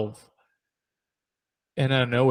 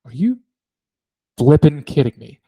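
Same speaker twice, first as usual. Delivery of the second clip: retold disbelief; 4 dB louder than usual. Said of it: "She went".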